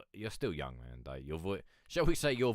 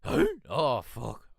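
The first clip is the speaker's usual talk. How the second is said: voice cracking; 5 semitones higher, 9 dB louder, much slower than usual. Retold or imitated. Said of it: "voice breaks".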